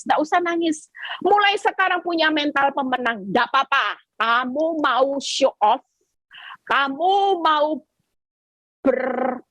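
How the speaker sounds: normal talking voice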